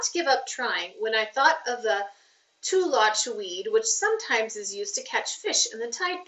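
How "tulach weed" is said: ordinary delivery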